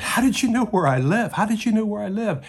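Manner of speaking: a terrified man caught out by a stranger; freaking out. Acted. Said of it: "he's really freaking out".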